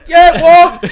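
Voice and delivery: loud, high-pitched yell; shouting back. Retold or imitated. Man answering a stranger on the platform, shouting back. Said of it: "she shouting back".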